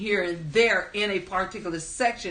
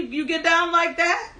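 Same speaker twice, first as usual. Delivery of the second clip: high, laughing disbelief; amused high-pitched teasing question; laughing tease; 7 semitones higher, 4 dB louder than usual. Real, not acted. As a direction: tease them through a laugh. Teasing a chatter who asks for song.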